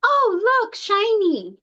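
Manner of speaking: sudden high, bright-eyed squeak; high-pitched gasp at something shiny; distracted delight. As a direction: switch instantly to childlike wonder. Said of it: "catastrophic crying to suddenly".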